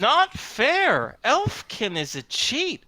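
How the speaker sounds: high, petulant fairy whine; whiny fairy. Acted. Fairy calling Al a cheat, high and whiny. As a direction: pout and accuse like a spoiled sprite.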